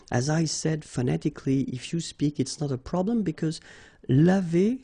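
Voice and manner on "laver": "laver" said clearly and louder as lah-vay; demonstrated pronunciation. Shown as a demonstration. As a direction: show how "laver" sounds: lah-VAY, lifted at the end. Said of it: "you pronounce it like that".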